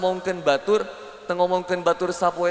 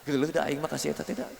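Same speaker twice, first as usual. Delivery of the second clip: low, soft, fast disdain; imitated catty aside behind her back; sadistic behind the back; 3 semitones lower, 7 dB quieter, faster than usual. Woman to neighbour about her friend, cattily disdainful. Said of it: "sadis di".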